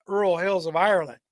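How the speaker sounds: plain reading voice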